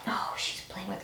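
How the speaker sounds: whispered aside; whispering. Real, not acted. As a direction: whisper it softly. Aside about cat.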